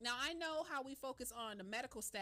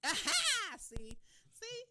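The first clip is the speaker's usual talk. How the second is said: high, loud gotcha; triumphant aha; 11 semitones higher, 8 dB louder, slower than usual. Real, not acted. Host catching the co-host's answer, triumphant. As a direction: pounce on the answer.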